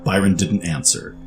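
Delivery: usual speaking voice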